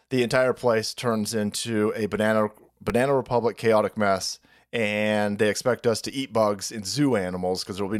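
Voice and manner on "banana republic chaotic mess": usual speaking voice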